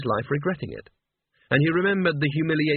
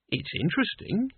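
softer, higher-pitched, tentative; slow and thoughtful; 5 semitones higher, 4 dB quieter than usual. Acted. Boy considering Death's job offer, slow and tentative. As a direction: weigh it up quietly in a higher voice. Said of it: "said Mort slowly".

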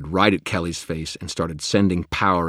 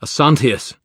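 shouted spell; 9 semitones higher, 11 dB louder than usual. Acted. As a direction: cry out the spell word, high and loud. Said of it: "and cried".

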